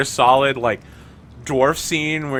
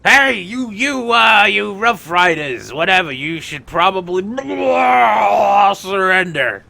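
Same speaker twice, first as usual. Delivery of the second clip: high, raised voice with halting stumbles; dazed Tenebris; 6 semitones higher, 5 dB louder than usual. Acted. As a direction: bluster through a demand to surrender. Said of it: "he's like".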